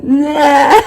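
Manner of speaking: put-on loud, high bratty kid noise; four-year-old brat. Grandmother imitating four-year-old grandson crashing the video call, loud bratty kid. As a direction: blurt it like a kid butting in. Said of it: "comes the four-year-old, like, in the screen going".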